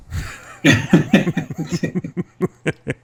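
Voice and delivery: laughs. Real, not acted. Laughing at father's dry reply.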